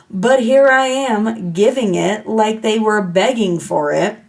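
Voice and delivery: usual speaking voice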